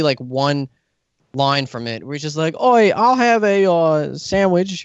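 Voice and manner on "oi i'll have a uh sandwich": higher, louder, slow attempt at a British accent; bad British accent. Tom Holland ordering at the deli, botched British accent. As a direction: ham up a clumsy British accent, then give up. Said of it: "or I can't do a British accent".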